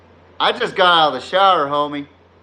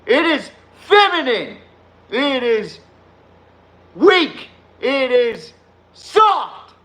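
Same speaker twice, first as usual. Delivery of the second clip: building rant; 6 semitones higher, slower than usual. Real, not acted. Ranting to chat about the dress, climbing to a shout.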